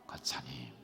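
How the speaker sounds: soft, tender calling of the title; gentle call. Shown as a demonstration. Pastor shows the congregation how to call gently. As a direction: call her kindly, almost a whisper. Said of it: "얼마나 부드러워요".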